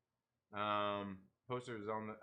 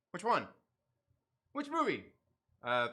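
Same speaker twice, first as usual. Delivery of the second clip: ordinary delivery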